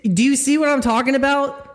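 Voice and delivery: high mocking dude voice, pitched well up; high put-on caller voice. Streamer mocking an outraged caller, high put-on voice. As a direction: squeak it out like an outraged good ol' boy.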